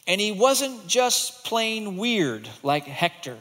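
put-on lively read-aloud to children; storybook reading. A narrator's reading. Teacher reading a picture book to kids. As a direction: read it big and slow for the class.

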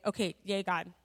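ordinary talk

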